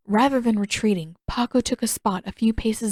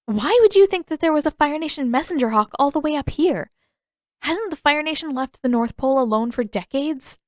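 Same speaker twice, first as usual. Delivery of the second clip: feigned steady challenge; 7 semitones higher, 4 dB louder than usual. Acted. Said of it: "keep her tone and her expression steady".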